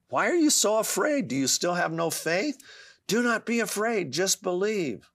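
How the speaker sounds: high, insistent pleading; urgent appeal. Acted. Jesus challenging a frightened father's faith, urgent appeal.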